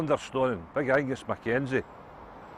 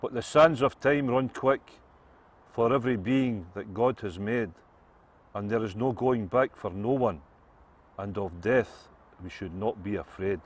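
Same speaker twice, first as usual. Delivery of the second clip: solemn verse; 3 semitones lower than usual. Acted. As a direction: recite it like a grave old rhyme. Solemn verse opening a joke.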